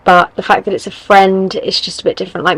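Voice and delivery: ordinary delivery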